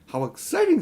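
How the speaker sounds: high, thrilled gasp of delight; excited. A narrator's reading. Polly thrilled by Diggory's pirate idea. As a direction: sound thrilled by the mystery. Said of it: "said Polly".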